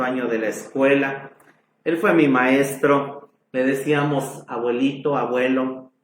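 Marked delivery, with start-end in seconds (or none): none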